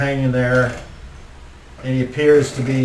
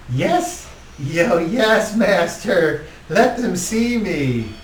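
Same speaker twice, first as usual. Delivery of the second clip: higher, slow groveling reply; fawning servant creature; 6 semitones higher, much slower than usual. Acted. Baldo answering his master Strahd, higher and groveling. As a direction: simper like a servile little minion.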